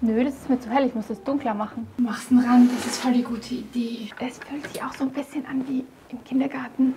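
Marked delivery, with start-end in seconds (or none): 2.8-6.9 hushed whisper under the breath; soft unvoiced whisper while painting. Real, not acted. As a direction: whisper it as if not to disturb. Quiet remarks while painting.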